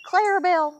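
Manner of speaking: sharp high call across the yard; yelling for the dog to come back. Real, not acted. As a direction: call her name out high and loud. Calling out to the dog.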